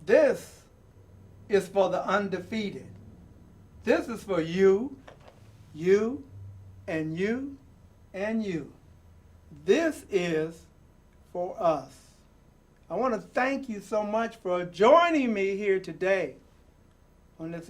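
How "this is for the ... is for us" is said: rhythmic, building reading with falling endings; dramatic poem reading. Acted. Host reading a picture book poem to young viewers, rousing. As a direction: read the poem like a rallying call. Reads The Undefeated poem aloud.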